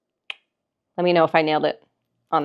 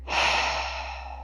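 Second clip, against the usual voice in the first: soft audible exhale; sighing breath; 10 dB quieter than usual. Shown as a demonstration. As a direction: let out a long, airy sigh. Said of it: "take another sighing breath".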